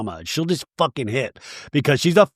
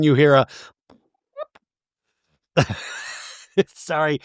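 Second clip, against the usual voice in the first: ordinary talk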